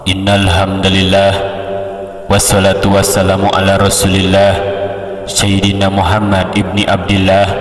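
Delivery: slow, measured opening with pauses; stately sermon opening, steady and spaced; broken into phrases. Shown as a demonstration. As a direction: chop it phrase by phrase on an even tone. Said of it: "dipotong-potong".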